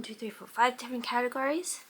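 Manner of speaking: ordinary talk, nothing marked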